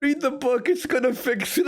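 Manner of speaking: high, whiny imitation of begging; mock pleading. Co-streamer imitating Joe pushing the book, mock pleading.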